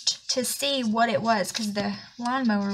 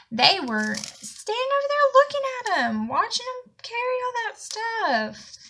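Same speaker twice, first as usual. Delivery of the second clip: high-pitched, indignant disbelief; irritated; 12 semitones higher, 5 dB louder than usual. Real, not acted. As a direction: pitch soaring in exasperation over the neighbours. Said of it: "it made me so irritated".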